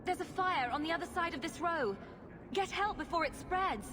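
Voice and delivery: high, urgent warning; feigned alarm. Pretend. Intruder sends guard off to a fire, urgent alarm.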